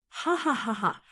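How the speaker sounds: short, scornful ha-ha-ha; mocking laugh. Prisoner scoffing at the sect master with a laugh. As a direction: laugh him off with contempt.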